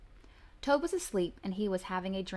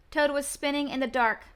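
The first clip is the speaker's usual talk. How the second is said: ordinary delivery, nothing marked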